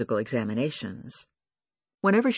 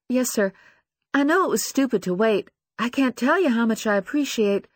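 higher, apologetic voice; deferential young woman; 9 semitones higher, 4 dB louder than usual. Acted. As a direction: sound small and grateful.

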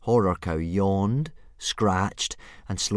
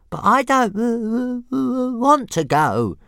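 high, stuttering dragon voice, matter-of-fact; a tiny dragon calmly refusing; reasonable little dragon; 9 dB louder, slower than usual. Acted. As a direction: Toothless calmly refusing Hiccup. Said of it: "Toothless pointed out, equally reasonably".

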